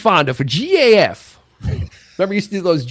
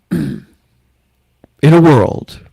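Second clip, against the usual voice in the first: very slow, low trailer voice; movie trailer voice; 4 semitones lower, 5 dB louder, much slower than usual. Shown as a demonstration. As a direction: drag it out like a trailer. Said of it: "You wanna give it a try?".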